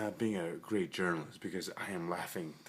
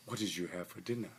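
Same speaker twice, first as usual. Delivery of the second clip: ordinary talk, nothing marked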